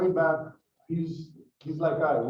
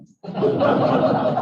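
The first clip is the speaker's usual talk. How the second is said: loud laugh; 8 dB louder than usual. Real, not acted. Audience laughs at punchline.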